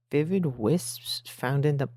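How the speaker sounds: ordinary delivery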